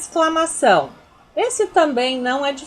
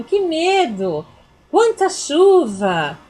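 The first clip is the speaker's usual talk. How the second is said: slow, louder exclaiming; emphatic exclamations; 4 semitones higher, 3 dB louder, much slower than usual. Shown as a demonstration. Teacher reading sample exclamations, emphatic. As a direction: stretch each exclamation out. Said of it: "vai um ponto de exclamação".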